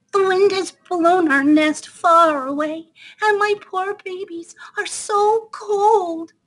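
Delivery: high, slow mousy voice; squeaking mother mouse. Acted. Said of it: "squeaked Mother Mouse".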